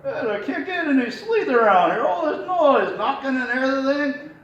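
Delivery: grumpy puppet out of the trunk; puppet's natural voice. Shown as a demonstration. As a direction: open the trunk and complain. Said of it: "that's a different voice, that muffled voice".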